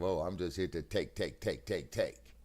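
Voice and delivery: ordinary talk, nothing marked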